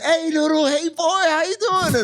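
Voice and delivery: put-on high, sing-song baby talk to a dog. Greeting Noodle the dog in a high cooing voice.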